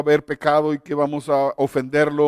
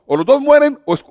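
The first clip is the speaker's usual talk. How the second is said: mock officer ultimatum; 7 semitones higher, 6 dB louder than usual.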